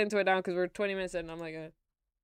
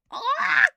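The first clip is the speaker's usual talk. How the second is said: short, high, loud "Ah!"; imitated scream; 8 dB louder than usual. Streamer imitating a voice in a song, high loud scream. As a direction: blurt a sudden high-pitched mock scream. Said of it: "so it's be like".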